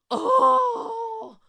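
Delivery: high gasp into loud oh; shocked gasp. Real, not acted. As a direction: gasp at the juicy jab. Shocked by Robin's question.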